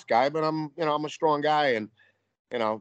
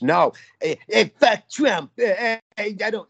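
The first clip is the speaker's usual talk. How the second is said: mocking a leftist; 10 semitones higher, 3 dB louder than usual. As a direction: squeal it like a smug protester mocking Trump. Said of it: "self-righteous".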